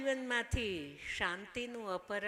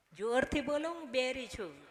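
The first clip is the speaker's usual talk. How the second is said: louder, higher call to the crowd; 4 semitones higher, 7 dB louder than usual. Real, not acted. Preacher urging the audience to answer loudly, calling out. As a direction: urge the hall to shout the answer back.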